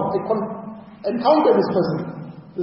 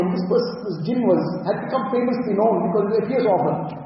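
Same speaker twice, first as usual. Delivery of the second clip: ordinary delivery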